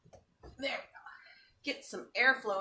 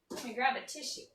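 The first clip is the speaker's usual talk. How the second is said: normal talking voice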